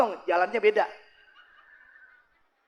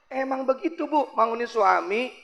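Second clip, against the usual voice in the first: normal talking voice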